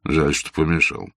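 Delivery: ordinary delivery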